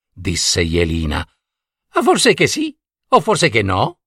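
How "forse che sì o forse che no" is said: high, playful riddling; teasing riddle voice. A narrator's reading. Fox Tatanaia teasing Jelina with the riddle. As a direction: tease her with the riddle. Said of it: "disse Tatanaia".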